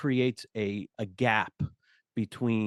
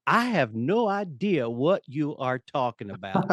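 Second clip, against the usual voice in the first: imitating a tall Southern managing director in a light Southern accent, pitched higher; 4 semitones higher than usual. A Southern managing director answers the young tech executive, in a mock Southern accent. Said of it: "he had this Southern accent".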